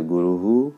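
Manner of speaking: गुरुः said as guru-hu with a light final hu; visarga said as hu. Shown as a demonstration. Teacher showing students the visarga in गुरुः, ending guru-hu. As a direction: end गुरुः with an echoing hu. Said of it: "उसका उच्चारण हु जैसा".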